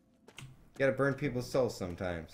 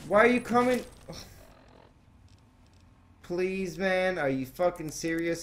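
exasperated yell; 8 semitones higher, 4 dB louder than usual. Real, not acted. Enemy charging at player.

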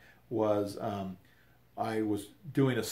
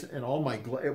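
ordinary talk, nothing marked

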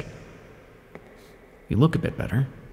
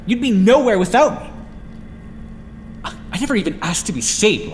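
high, loud retort; sharply raised, offended protest; indignant outburst; 7 semitones higher, 3 dB louder than usual. Acted. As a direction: throw it back at them, voice leaping up. Arguing with Liza, defiant.

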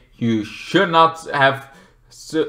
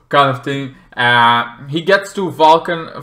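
normal talking voice

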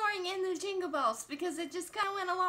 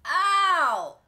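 sharp loud cry of pain; 9 dB louder than usual. Real, not acted. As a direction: yelp it out.

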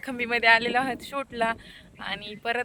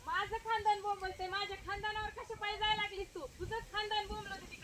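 high voice, quieter sulky retort; भांडखोर बाई; 11 semitones higher, 9 dB quieter than usual. Acted. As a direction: grumble back as an insulted village woman. (उंच पण हळू आवाजात उलट उत्तर)

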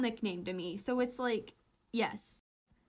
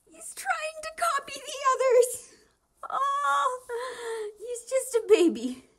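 much higher, louder cooing, almost crying; 8 dB louder than usual. Real, not acted. Fan gushing over a character copying others, high cooing.

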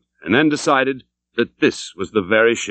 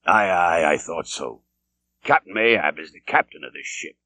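lower, a grim sailor who expected this; knowing captain; 5 semitones lower than usual. A narrator's reading. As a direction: drop the voice like an old hand.

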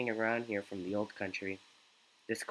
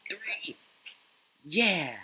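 excited cheer; 10 semitones higher, 5 dB louder than usual. Real, not acted. Nailing the third jump.

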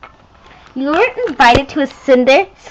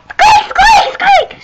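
high, loud squeaky mouse cries with a laugh; mouse squeaks; 4 dB louder, slower than usual. Acted. Mouse toy squeaking back at its owner, high laughing squeaks.

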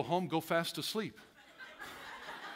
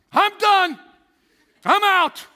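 much louder, higher mock shout of quitting; fed-up outburst; 9 semitones higher, 10 dB louder, slower than usual. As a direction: act out snapping at the last straw. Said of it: "makes all of them".

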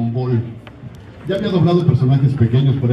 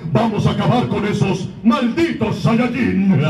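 slow, high, stronger villain voice; Freezer, first transformation; 8 semitones higher, slower than usual. Acted. Voice actor demoing Freezer's transformed voice, higher and slower. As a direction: menace the Saiyans slowly from above. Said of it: "primera transformación habla así".

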